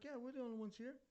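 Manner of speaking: high-pitched imitation of a woman; older woman. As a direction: put on a high female voice, confirming. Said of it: "She's like".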